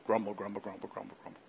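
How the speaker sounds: low, soft grumble dying away; quiet repetitive muttering; grumbling. Shown as a demonstration. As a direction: grumble under your breath. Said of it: "that sounds like what it is".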